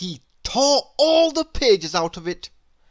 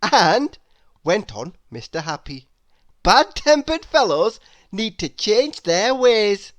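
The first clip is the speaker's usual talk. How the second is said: higher, louder cheerful lecturing; cheerful Mister Happy; 4 semitones higher, 7 dB louder than usual. Acted. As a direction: wag a friendly finger.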